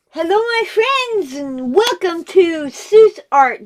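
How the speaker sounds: high, loud, slow welcome; vlog intro. Real, not acted. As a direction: welcome viewers like a host. Channel intro greeting.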